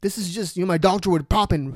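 usual speaking voice

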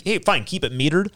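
normal talking voice

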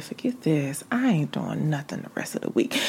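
soft breathy whisper, defeated; unvoiced mock surrender whisper; whispered giving up. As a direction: hush it like a guilty confession. Retelling giving up, voiced whisper.

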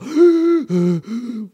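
big high panting gasp; loud gasp. Pretend. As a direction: gasp hard for air after rowing. Obese man rowing for Big Mac.

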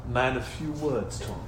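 low, slow, soft pretend persona; man of few words. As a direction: play the laconic man, unhurried. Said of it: "I wanna be like".

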